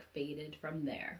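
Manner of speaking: plain reading voice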